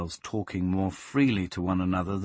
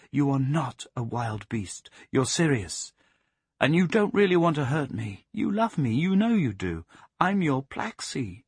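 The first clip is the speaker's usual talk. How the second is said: put-on higher pleading young woman's voice; Plaxi soothing; 5 semitones higher than usual. Plaxi pleading with the savage dog to remember her. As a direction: coax him gently, rising and pleading.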